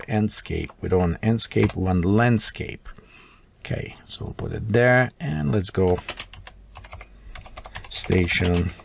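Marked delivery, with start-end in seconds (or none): none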